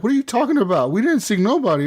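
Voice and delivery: high-pitched mock innocence; imitated indignant denial. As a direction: plead not guilty as the baffled victim. Retold denial by accused players.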